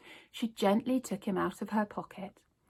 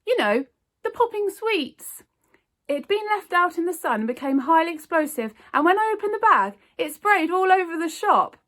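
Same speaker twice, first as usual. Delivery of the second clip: put-on girl's quick fib; 9 semitones higher, 8 dB louder than usual. A narrator's reading. Zoe's excited cover story.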